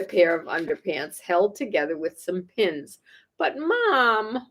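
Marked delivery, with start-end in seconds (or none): 3.4-4.5 whine. Acted. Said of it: "whined Froggy".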